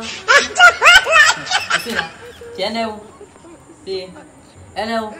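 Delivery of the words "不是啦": laughing shriek. Acted. Mock shriek while laughing.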